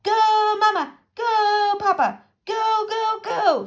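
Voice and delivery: high, loud rhythmic chant; exuberant shout. A narrator's reading. Dancing ghost captain to his crew, exuberant shout. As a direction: cheer them on with long "Go"s. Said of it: "shouted the ghost of Jean Lafayette".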